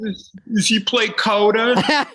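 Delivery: very high, slow put-on voice; mock fan. A clueless fan quizzing an actor about Coda, high slow put-on voice.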